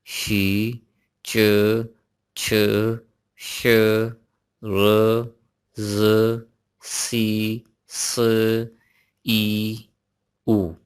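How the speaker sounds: each consonant sound said alone, slow and clear; Chinese initials drilled. Shown as a demonstration. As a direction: sound out every initial one by one. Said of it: "मेरे साथ इसको revise कीजिए". (चीनी प्रारंभिक ध्वनियाँ अलग-अलग)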